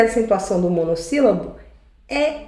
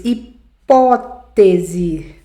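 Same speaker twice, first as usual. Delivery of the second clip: hipótese; 4 semitones lower, much slower than usual. Shown as a demonstration. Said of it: "Aí eu trouxe aqui pra vocês".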